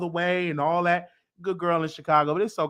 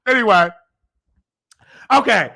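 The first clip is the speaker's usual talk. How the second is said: ordinary talk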